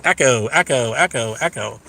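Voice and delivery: fading echo effect; mock echo. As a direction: repeat it like a dying echo, dropping away. Mimics an echo chamber.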